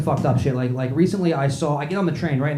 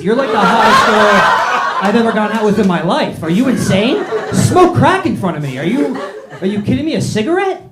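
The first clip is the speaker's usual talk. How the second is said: loud, high-pitched comic outrage; incredulous rant; 6 semitones higher, 7 dB louder than usual. Retold or imitated. Man answers his date's worry about smoking, loudly incredulous. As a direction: rant in mounting disbelief, pushing louder and higher.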